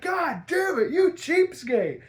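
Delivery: very high shout, slowing at the end; Harley furious. Retold or imitated. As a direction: scream at her stingy man. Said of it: "And she's like".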